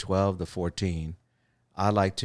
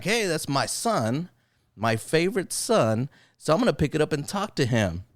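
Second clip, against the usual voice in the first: imagined happy dad; 8 semitones higher than usual. Pretend. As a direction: play the beaming father spotting his son's call. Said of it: "He's like".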